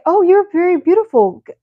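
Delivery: lightly mimicked smooth-talking stranger; mock sweet-talk to a stranger; intruder's line. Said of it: "is like".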